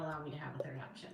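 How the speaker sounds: fast breathy whisper. Real, not acted. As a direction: whisper it quickly under your breath. Repeats complaint, second take whispered.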